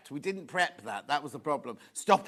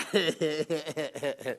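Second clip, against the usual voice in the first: long helpless giggle; real fit of the giggles; uncontrolled laugh; 2 semitones lower than usual. Real, not acted. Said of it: "we'll pull it together now".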